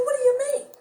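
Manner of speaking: high, shocked what do you mean. Retold or imitated. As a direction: react as if it can't be true. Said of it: "I said".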